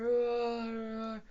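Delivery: drawn-out, sleepy dinosaur roars; tired roar. Acted. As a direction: roar as if dozing off. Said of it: "We're getting more tired".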